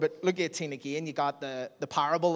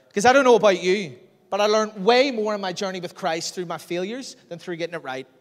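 higher, much louder impassioned plea; fervent prayer; 5 semitones higher, 9 dB louder than usual. Acted. Believer throwing failure at Jesus, loud fervent prayer. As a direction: cry it out to God with force. Passionate repentant prayer voiced.

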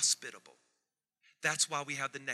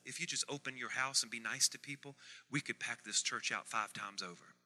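ordinary talk, nothing marked

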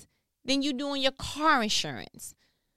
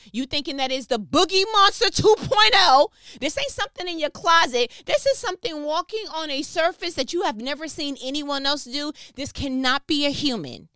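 much louder and higher, building to full force; fired-up preaching; 4 semitones higher, 9 dB louder than usual. Real, not acted. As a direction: preach the terror of the moment hard. Describing the disciples' fright.

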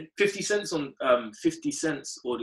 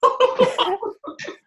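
loud laugh; 9 dB louder than usual. Real, not acted. Laughing at the demon time claim.

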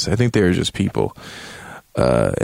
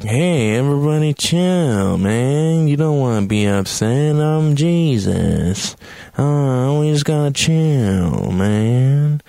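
drawn-out, higher chilled-out dude voice; Jesus; 5 semitones higher, slower than usual. Retold or imitated. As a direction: stretch the words like a hammed-up mellow peacemaker. Acts Jesus telling everyone to chill.

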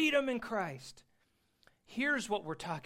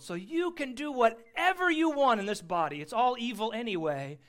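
loud, higher, emphatic mock permission; mock carefree proclamation; 6 semitones higher, 7 dB louder than usual. Preacher voicing the false teachers' argument to his congregation, loud and mock carefree. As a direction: belt out the false teaching as if it were liberating.

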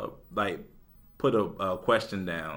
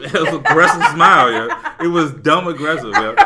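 laughing hard, higher and louder; 5 semitones higher, 10 dB louder than usual. Real, not acted. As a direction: talk through a laughing fit. Laughing over the aggressive smile.

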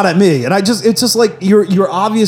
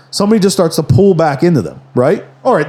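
usual speaking voice

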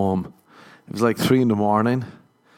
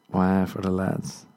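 usual speaking voice

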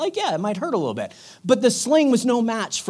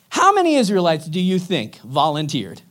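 ordinary delivery

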